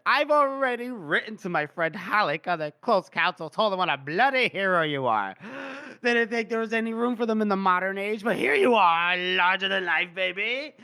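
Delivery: hammed-up, gushing drunken praise; boisterous drunk. Drunk Felnik hailing Gorst as a hero, hammed-up gushing. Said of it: "he's just drunk and just like".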